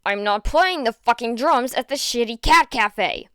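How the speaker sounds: loud snarling refusal; loud furious refusal; angry refusal. Acted. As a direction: spit it out angrily. Bakugo refuses angrily.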